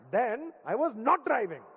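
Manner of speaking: put-on dad's Indian accent. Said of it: "make everything sound Indian".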